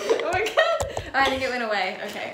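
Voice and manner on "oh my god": high squeaky helium laugh. Real, not acted. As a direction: giggle in a squeaky voice. Helium voice test.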